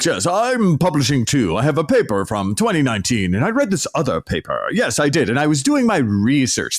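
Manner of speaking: hammed-up pretend-professor voice; mock pseudo-academic. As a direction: send up a cosplaying professor one-upping colleagues. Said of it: "And the next guy will be like".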